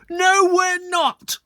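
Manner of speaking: very high, loud, slow scream. Acted. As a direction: shriek it together in outrage. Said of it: "screamed the girls".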